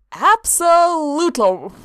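loud, slow cheerful yes; cheerfully. A narrator's reading. Sanguine gladly welcomes the watcher, cheerful. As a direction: beam it out. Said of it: "Sanguine said cheerfully".

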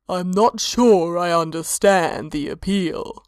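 slow otherworldly god voice. A narrator's reading. Mora, a slow deep god.

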